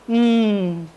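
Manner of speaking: drawn-out, noncommittal British hum; Gillian Beer. Retold or imitated. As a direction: imitate an understated British woman's only response. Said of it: "all she said as a British woman".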